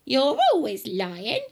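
loud, high, accusing granny; scornful old-woman shout; yelling grandma. Acted. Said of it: "Grandma yelled".

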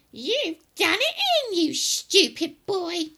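loud, high granny rebuke; scolding grandma. Acted. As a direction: berate the boy as a cranky old woman.

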